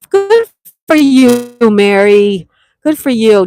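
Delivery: high, loud burst of delight; excited cheer. Real, not acted. Viewer took the day off, excited high cheer. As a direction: cheer her on, thrilled.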